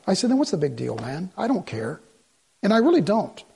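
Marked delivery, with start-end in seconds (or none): 0.3-2.0 low, much softer, shrugging it off; soft brush-off. Retold or imitated. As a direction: let it drop away to almost nothing. Retold calm brush-off to colleague.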